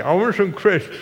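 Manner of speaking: hammed-up mouth-full child voice; mouth full of cake. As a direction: act a little girl talking through cake. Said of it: "She can barely speak".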